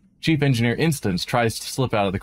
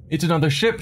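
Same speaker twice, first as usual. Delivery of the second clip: excited, higher exclamation; exclaiming; 6 semitones higher, faster than usual. A narrator's reading. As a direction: call it out with sudden hope. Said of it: "she exclaims".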